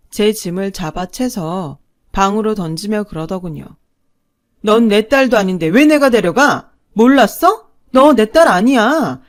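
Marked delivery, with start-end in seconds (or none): 4.6-9.2 much louder, higher cutting yell; cruel shouting. Acted. Mother disowns daughter, cruel loud shouting. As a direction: hurl it at her to wound.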